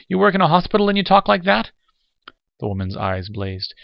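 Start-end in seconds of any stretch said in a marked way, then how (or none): 0.1-1.7 much higher, louder agitated outburst; excited stutter. A narrator's reading. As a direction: blurt it out, shocked and worked up. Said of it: "Lamar stuttered excitedly".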